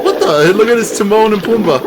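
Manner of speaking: high, loud cartoon meerkat voice; imitating the meerkat. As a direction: squeak it out like a startled cartoon critter. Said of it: "He's like".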